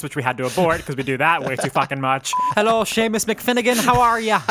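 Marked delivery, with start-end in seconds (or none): 2.5-4.4 hammed-up Irish greeting; cheerful Irish pub hello; Irish accent. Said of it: "We started in Irish accents".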